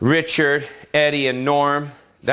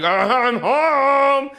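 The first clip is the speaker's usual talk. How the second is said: creepy Buffalo Bill; 11 semitones higher, much slower than usual. Retold or imitated. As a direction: drawl it like an unsettling killer. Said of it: "I don't know how he speaks".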